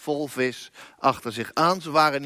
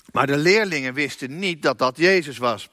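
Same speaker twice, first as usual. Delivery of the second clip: normal talking voice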